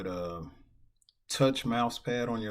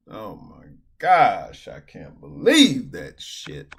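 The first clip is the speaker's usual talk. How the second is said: slow, loud exasperated groan; loud disbelief; 10 dB louder, slower than usual. Real, not acted. Player reeling from the folded kings, slow loud outrage. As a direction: drag it out, outraged. Reacting to the misclick.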